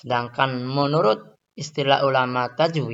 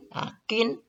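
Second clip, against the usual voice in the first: ordinary talk, nothing marked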